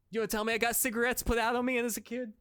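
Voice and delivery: put-on loud, fast, high Boston accent; hammed-up Boston tough guy. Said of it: "my impression of, uh, Dee Reynolds and Sonny doing Good Will Hunting".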